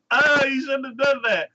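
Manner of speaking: high, whiny, crying-toned complaint; a hammed-up whimpering reproach; fake-tearful you shouldn't have done that; mock whiny scold. Girl scolds hero, retold.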